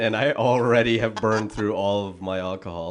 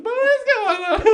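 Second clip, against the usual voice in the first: very high-pitched, loud sidekick squeal; imitating high voice; 6 dB louder than usual. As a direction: mimic a friend shooting up into an exaggerated high register. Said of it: "where you speak really high".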